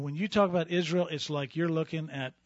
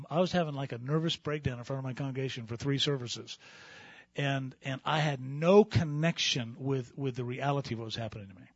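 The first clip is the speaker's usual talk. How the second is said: ordinary talk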